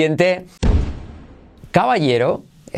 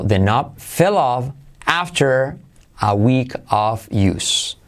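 slow "the knob fell off after", pause, "a week of use"; careful learner-paced translation; slow model; slower than usual. Shown as a demonstration. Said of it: "el pomo se cayó".

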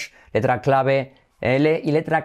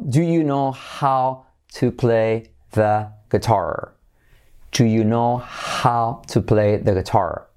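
slow; much slower than usual. Shown as a demonstration. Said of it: "practicar la R".

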